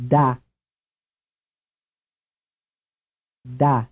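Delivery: Da with a dental d; Italian Da, open "ah"; Italian syllable. Shown as a demonstration. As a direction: model Da with tongue on upper teeth. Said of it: "repeat the Italian".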